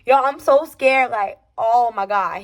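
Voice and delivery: ordinary talk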